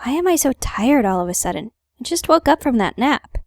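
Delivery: normal talking voice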